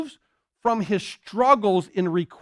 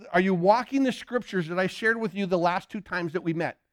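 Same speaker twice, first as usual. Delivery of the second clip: ordinary talk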